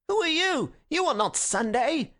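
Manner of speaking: high-pitched heckle from the crowd; called out. A narrator's reading. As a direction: challenge him indignantly. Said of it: "one anarchist called out to Gregory".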